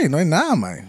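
normal talking voice

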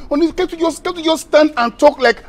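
exasperated pleading. Acted. Man pleading with his girlfriend to talk, high and agitated.

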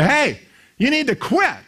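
high, pushy jab at him; woman nagging the pastor at his door; mock confrontation. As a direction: get in his face, high-pitched. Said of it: "get in his face".

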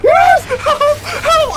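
high loud cries for help; yelling for help. Real, not acted. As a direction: scream out for help. Calling for help in a fight.

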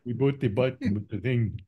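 put-on Punjabi accent. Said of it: "you have to do it with a Punjabi accent".

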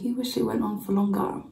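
ordinary talk, nothing marked

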